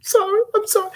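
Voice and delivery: very high, loud sobbed apology; hammed-up breathless blubbering; mock crying. Woman crying to her date before appetizers, mock sobbing. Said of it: "sitting up here crying".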